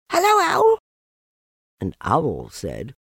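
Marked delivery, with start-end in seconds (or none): 0.1-0.8 very high little squeak. Acted. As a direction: squeak it like a tiny joey. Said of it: "he squeaked".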